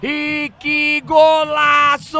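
long drawn-out shouted golaço, high and loud; explosive goal commentary. Real, not acted. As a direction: stretch golaço into a huge roar. Goal celebration call.

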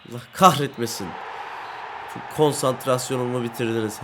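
ordinary talk

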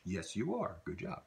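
soft praise for the student; encouraging praise. Shown as a demonstration. Teacher softly praises an imagined learner. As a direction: gently tell them well done. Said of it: "praising learners".

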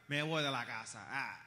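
low, grumpy husband voice; mock walking out. Husband answering a quarrel with his wife, low mock huff. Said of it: "¿cuál es tu respuesta?".

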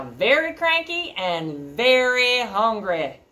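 slow, drawn-out "very hungry"; cranky emphasis. A narrator's reading. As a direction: stretch each "very" for effect. Narrator stresses troll's temper.

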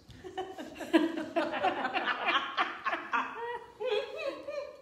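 long, high-pitched real giggle; laughing. Real, not acted. Pastor laughing at the children's joke, high real giggle. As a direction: let out a run of high laughter.

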